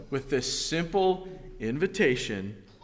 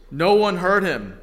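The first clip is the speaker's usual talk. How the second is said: usual speaking voice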